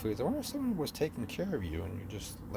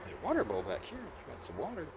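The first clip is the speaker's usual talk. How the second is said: put-on high, soft baby talk to a cat; 8 semitones higher, 4 dB quieter than usual. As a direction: coo it to the kitten. Cooing at a stray cat.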